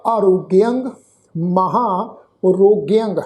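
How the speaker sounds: ਆਰੋਗਿਅੰਗ, ਮਹਾਰੋਗਿਅੰਗ with lingering long aa; slow demonstration of ਆਰੋਗਿਅੰਗ, ਮਹਾਰੋਗਿਅੰਗ, long vowels held; long vowels drawn out. Shown as a demonstration. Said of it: "ਲਮਕਾ ਕੇ ਵੀ ਕਰ ਸਕਦੇ".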